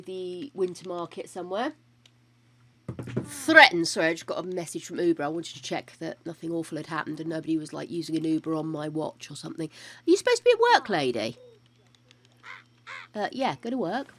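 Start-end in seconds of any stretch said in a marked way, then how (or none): 3.2-3.9 Threatened said loud and high, then falling; loud, high "Threatened" with a falling end; stressed repeat. Real, not acted. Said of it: "I have threatened Greg". 9.7-11.4 playful telling-off of a late sim; mock scolding.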